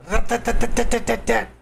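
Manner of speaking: mock gunfire. Imitating gunfire of defense system.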